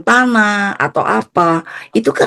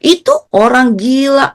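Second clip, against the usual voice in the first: higher, drawn-out dismissive grumble; mock exasperation, pitched up; grumbling dad; 7 semitones higher than usual. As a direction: imitate a father writing someone off. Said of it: "Papa saya ngomel-ngomel".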